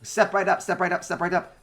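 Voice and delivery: high, loud robotic barker chant; mechanical barker voices. Acted. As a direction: call out like a carnival automaton hawking. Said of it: "cacophony of, uh, mechanical voices".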